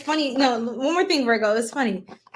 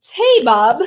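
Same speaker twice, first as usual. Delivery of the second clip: louder, clipped British-style tea; accent variant; 7 dB louder than usual. Shown as a demonstration. Streamer comparing accents, louder British tea. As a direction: show off a second accent of tea. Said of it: "Totally different accents".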